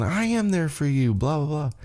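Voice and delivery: high-pitched, understated mock protest; mock yelling. Sammy arguing back at his tag partner, mock yelling. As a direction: imitate an argument without raising the volume. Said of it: "kinda yelling at each other".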